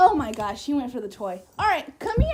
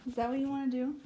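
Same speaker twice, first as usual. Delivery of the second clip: usual speaking voice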